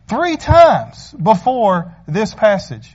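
slow emphasis. Acted. Stressing the count.

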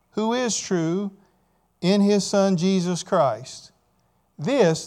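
very slow, deliberate verse reading; solemnly paced Bible reading; scripture reading. Acted. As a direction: read the verse phrase by phrase with long pauses. Reads scripture aloud to congregation.

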